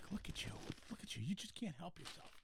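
teasing unvoiced whisper. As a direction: whisper it like scolding a pet. Teasing friend caught eating.

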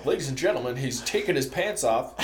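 loud, lively play-by-play announcer bit; playful announcer voice, projected; mock announcer. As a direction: announce it to the crowd like a ringside emcee.

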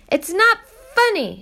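high, loud indignant protest. Acted. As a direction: snap back indignantly. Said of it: "she protested".

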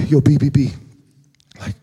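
low, laid-back buddy voice; low, casual mock-Chris musing; imitating friend Chris. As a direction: ask like a lazy pal. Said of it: "he's always like".

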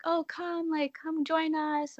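high-pitched mock calling out; imitating partiers yelling from a limo; retold yelling. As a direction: call out an invitation, without real volume. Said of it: "these people are just yelling out of it".

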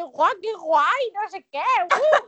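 gushing fan voice. Retold or imitated. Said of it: "en plan de".

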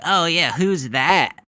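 mock clueless. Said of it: "You're just like".

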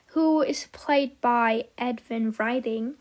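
ordinary talk

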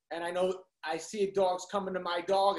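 ordinary talk

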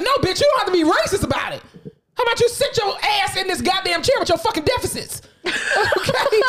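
high, heated pretend telling-off; mock rant. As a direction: tell him off with laughing indignation. Imagined rant at patient.

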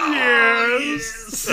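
high, loud laughing yes; gleeful shout. Retold or imitated. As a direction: bellow it like in the theater. Shouting the movie line.

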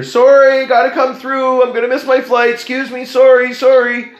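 high, loud calls clearing a path; yelling up the escalator. Retold or imitated. Runner yells to people on the escalator to move, loud and high. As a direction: call out ahead, high and loud, apologetic. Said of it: "I literally yell up the escalator".

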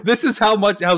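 high, loud laugh through the words; laughing disbelief. Real, not acted. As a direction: crack up while telling it. Laughing retelling friend's marble trick.

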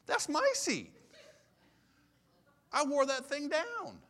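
very high, loud indignant protest; hammed-up, high-pitched outrage; mock outrage. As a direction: claim it like an affronted church kid. Relives outrage over his taken pew.

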